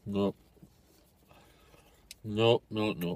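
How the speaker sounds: talking while chewing; words muffled by food; mouth full, chewing. Real, not acted. Seen 0: Food enters mouth at -1; closed, full-cheeked mouth chewing while speaking.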